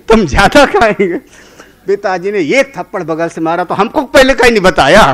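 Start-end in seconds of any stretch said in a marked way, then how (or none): 0.1-1.2 high, loud words through laughter; amused mock-boy voice; laughing boast. Boy's boast, voiced while laughing. 3.6-5.0 high, loud, rushed mock complaint; comic indignation in a retold quote; indignant father. Father slaps and complains in story.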